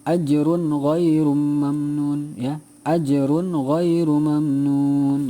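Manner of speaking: very slow chant, the n of "Ajrun" clear before gh; Quran recitation voice. Shown as a demonstration. Teacher chanting the verse for pupils, slow. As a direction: intone "Ajrun ghairu mamnun" slowly and clearly. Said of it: "Diulang ya".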